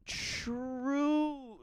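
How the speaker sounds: long, very high drawn-out word; comic agreement. Pretend. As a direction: stretch it out way up high.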